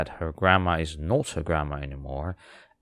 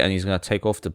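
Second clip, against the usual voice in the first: ordinary talk, nothing marked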